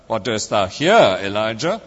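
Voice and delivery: low start, then "here" punched out; stress on HERE. Retold or imitated. Friend mimicking a preacher's emphasis, stress on "here". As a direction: lean hard on "here", then lift into the question. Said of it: "emphasizing each word in turn".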